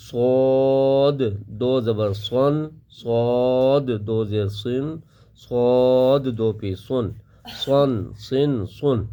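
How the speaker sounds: صاد slow, full and heavy; teacher drills the heavy صاد slowly. Shown as a demonstration. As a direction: draw out سن slow and thick. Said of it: "موٹی آواز کے ساتھ پڑھیں گے".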